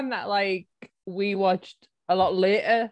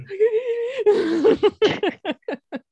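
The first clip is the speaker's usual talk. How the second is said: long laugh; 11 semitones higher than usual. Real, not acted. Laughing hard at the film.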